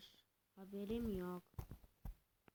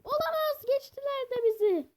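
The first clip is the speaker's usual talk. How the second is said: panic; 12 semitones higher, 10 dB louder than usual. Real, not acted. Enemies close in, panicked.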